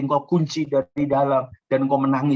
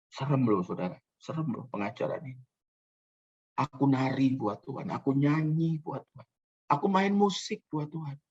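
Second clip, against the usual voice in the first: ordinary talk, nothing marked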